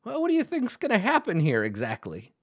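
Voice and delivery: a hint of a sly old dwarf questioning; mild high dwarf voice. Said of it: "he's like".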